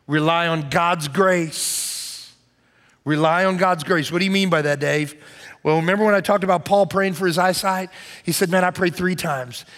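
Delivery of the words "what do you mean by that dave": usual speaking voice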